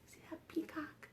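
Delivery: a secret whisper pointing something out. Real, not acted. Whispering to the kids about the peacock.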